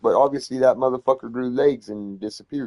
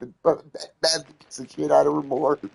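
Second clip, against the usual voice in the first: normal talking voice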